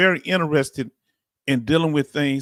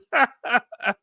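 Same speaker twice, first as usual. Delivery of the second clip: high laugh; 10 semitones higher than usual. Real, not acted. Streamer laughing at chat's joke, high laughter. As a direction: crack up at the chat.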